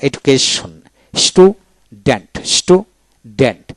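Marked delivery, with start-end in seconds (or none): 1.1-3.7 স্টুডেন্ট said slowly as two parts, স্টু and ডেন্ট; split into syllables. Shown as a demonstration. Teacher showing what a syllable is, slow split syllables. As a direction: leave a clear gap inside স্টুডেন্ট. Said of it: "এভাবে".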